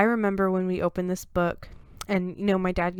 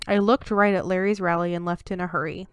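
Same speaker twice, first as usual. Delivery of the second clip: ordinary delivery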